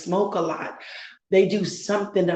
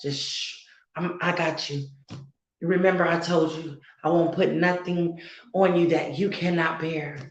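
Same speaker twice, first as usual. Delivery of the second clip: hushed, softer, tender reassurance down to a whispered shh; God's comforting voice; 3 dB quieter than usual. Acted. God soothing a weary believer who cannot pray, softly and tenderly. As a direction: speak softly as God soothing a tired child. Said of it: "he just wanna be that one to say".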